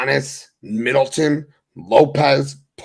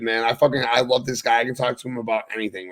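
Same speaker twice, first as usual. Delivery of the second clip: ordinary delivery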